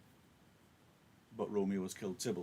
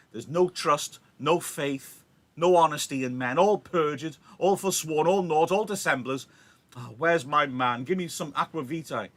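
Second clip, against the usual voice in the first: loud, higher, lamenting recital; dramatic Shakespeare reading; 6 semitones higher, 9 dB louder than usual. Acted. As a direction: wail the Nurse's grief out loud. Teacher reads the Nurse's lines.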